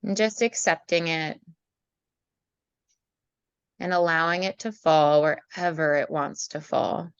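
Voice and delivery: calm meditation voice with long pauses; guided meditation. Acted. Leads a meditation.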